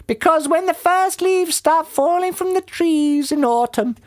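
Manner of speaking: high-pitched bird character; roly-poly bird. A narrator's reading. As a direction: warn in a very high creature voice. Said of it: "said the roly-poly bird".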